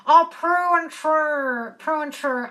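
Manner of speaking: slow, drawn-out comic sitcom voice; Prue and Trude. Acted. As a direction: put on an exaggerated, stretched-vowel character voice. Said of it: "Prue from Kath and Kim".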